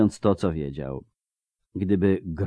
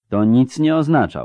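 raised, firm wizard's retort; curt dismissal; 5 semitones higher, 5 dB louder than usual. Acted. Wizard cutting off talk of an attack, curtly dismissive. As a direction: cut the argument off, louder. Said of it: "uciął czarodziej".

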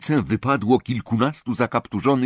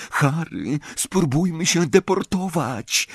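ordinary delivery, nothing marked

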